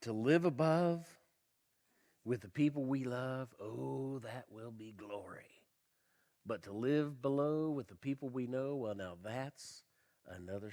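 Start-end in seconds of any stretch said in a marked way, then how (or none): 0.0-8.8 poem recitation. Acted. Reciting a known verse.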